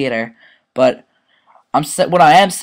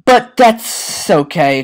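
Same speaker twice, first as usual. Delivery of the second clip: smug drawl; 6 semitones higher, 5 dB louder, much slower than usual. Acted. Mock cool boast.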